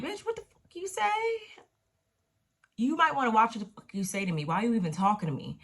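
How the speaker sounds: high, fast snap; imitating a stripper clapping back at a rival; mock angry comeback. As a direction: fire it back, ready to fight. Roulette snaps back in fight.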